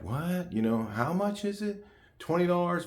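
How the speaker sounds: high, incredulous "What?" at the price tag; shocked at prices. Retold or imitated. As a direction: sound stunned by what things cost.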